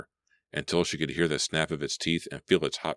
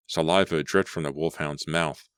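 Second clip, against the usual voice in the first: plain reading voice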